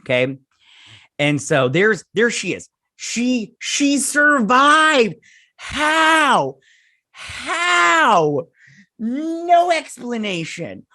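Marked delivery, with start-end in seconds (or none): 3.0-10.9 high, loud, slow incredulous complaint. Real, not acted. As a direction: cry out in real disbelief. Disbelief that a character survived.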